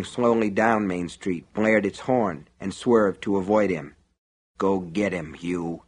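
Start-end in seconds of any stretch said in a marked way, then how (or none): 4.6-5.8 soft, low murmured command. A narrator's reading. Shopkeeper alone, watching customer drive off, low voice. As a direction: urge him on under your breath. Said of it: "said in a low voice".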